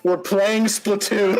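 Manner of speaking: excited anime fan. Acted. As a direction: stretch it out like an awkward otaku. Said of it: "Steven just went".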